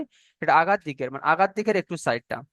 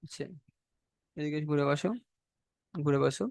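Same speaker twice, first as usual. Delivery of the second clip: quiet aside; 2 semitones lower, 9 dB quieter than usual. Real, not acted. Aside to a student mid-lesson.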